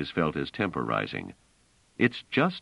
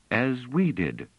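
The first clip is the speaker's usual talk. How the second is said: a lightly voiced proud lift, higher; proudly; 6 semitones higher, slower than usual. A narrator's reading. Lieselore proudly claims shared origin with Treves, voiced lightly. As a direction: claim the heritage with pride. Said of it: "said Lieselore proudly".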